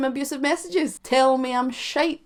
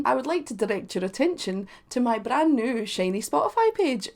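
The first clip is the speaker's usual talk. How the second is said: ordinary talk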